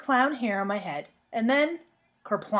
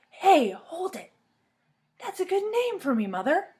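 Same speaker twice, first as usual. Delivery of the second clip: put-on excited girl; 9 semitones higher than usual. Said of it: "I clapped real excited".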